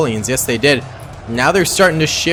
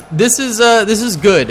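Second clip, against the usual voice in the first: loud, higher excited shout; 7 semitones higher, 5 dB louder than usual. Real, not acted. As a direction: cheer it out loud. Battle turns for Romans.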